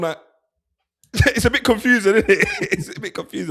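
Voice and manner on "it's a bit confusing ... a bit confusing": laughs through it's a bit confusing; amused laugh while calling his accent a bit confusing. Real, not acted. Laughing over a footballer's jumbled accent.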